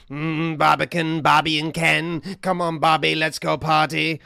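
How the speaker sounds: loud, sing-song mock tube announcement; mimicking announcer. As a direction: ham up a strange man parroting the tube announcer. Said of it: "he mimicked it and then added new things".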